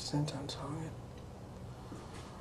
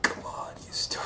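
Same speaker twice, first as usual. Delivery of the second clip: frustrated whisper; 3 dB quieter than usual. Real, not acted. Cursing the app.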